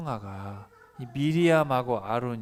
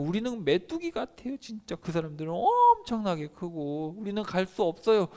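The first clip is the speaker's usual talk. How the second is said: higher, timid report; frightened spies; 6 semitones higher, 3 dB louder than usual. Acted. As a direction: shrink as you describe the giants. Scared spies report giants.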